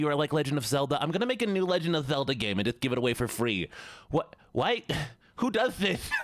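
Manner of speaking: high, incredulous lisping nerd. Pretend. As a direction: rant like an amazed lisping nerd. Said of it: "I still have this voice more".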